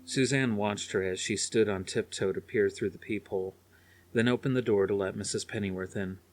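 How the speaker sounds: ordinary delivery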